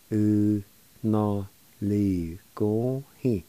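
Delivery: "U na li go hi." slowly, every syllable stretched; slow, careful pronunciation. Shown as a demonstration. Teacher repeating "U na li go hi." for learners, slowly. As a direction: draw out each syllable of "U na li go hi.". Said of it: "It's pronounced like this".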